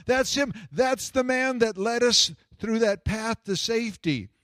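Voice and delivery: high, urgent insistence; excited yelling. Retold or imitated. Woman telling them who led her to safety, excited. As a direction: imitate her thrilled, high-pitched recognition. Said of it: "she gets excited and she starts yelling".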